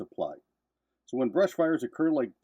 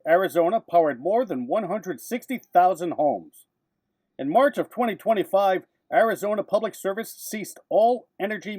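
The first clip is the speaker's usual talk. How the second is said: punchy newsreel narration, louder and higher; old newsreel narrator; 6 semitones higher, 5 dB louder than usual. Acted. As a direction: announce it like a vintage newsreel. Dramatic narrated news segment.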